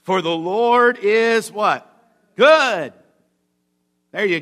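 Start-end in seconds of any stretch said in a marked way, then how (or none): 0.0-1.5 high, loud, slow proclamation; rousing preacher's declaration; wholehearted praise. Acted. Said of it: "The attitude the psalmist says is". 2.4-2.9 high, loud, falling exclamation; exultant one-word proclamation; wholehearted praise. Acted. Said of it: "The attitude the psalmist says is".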